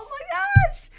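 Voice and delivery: high-pitched, loud amazement; astonished gasping. Retold or imitated. Family sees Chance return, high astonishment. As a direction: react as if your jaw just dropped. Family's jaws drop at the sight.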